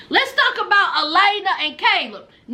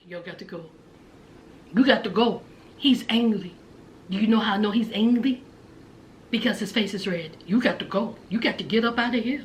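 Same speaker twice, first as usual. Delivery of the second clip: put-on much lower, softer drunk woman's voice; imitating Jasmine; 10 semitones lower, 6 dB quieter, faster than usual. Jasmine tells angry Gino to leave, low soft voice. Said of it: "And Jasmine says".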